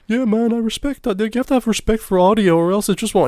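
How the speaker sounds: high mock-wise tone; mock earnest self-quote. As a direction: send up your own pompous line. Quoting his last recorded words.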